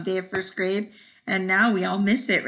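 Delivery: usual speaking voice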